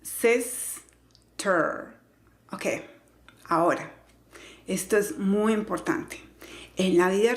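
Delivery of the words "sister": SISTER in two slow, separated syllables; slow teaching demo of sister, evenly weighted. Shown as a demonstration. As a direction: sound out SIS, pause, then TER. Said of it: "simplemente se pronuncia".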